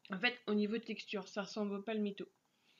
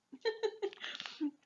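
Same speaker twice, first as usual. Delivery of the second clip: high giggle; 11 semitones higher than usual. Real, not acted. Laughing at the pet's face.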